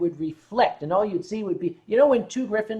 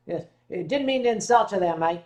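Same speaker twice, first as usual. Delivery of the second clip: ordinary talk, nothing marked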